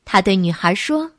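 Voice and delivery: ordinary delivery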